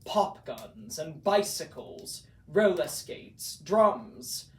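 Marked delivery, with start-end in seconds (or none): none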